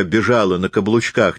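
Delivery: ordinary delivery, nothing marked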